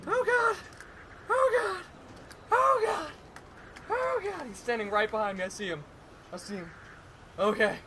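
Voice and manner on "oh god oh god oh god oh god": high-pitched panicked cries. Real, not acted. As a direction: yelp in real panic. Enemy behind him, no controller.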